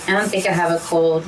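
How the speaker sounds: ordinary delivery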